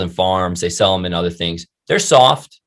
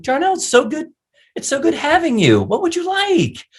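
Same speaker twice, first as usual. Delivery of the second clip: very high falsetto hospitality voice; hammed-up sugary welcome, far above your own pitch; gushing server. Chick-fil-A worker welcoming a customer, gushing.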